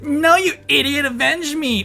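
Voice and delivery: high annoyed last words; exasperated dying plea. Acted. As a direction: scold her with your last breath.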